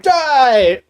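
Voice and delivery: high, loud shout of "Die"; battle cry. Acted. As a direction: scream it as you charge. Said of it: "it's like".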